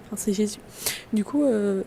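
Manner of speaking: usual speaking voice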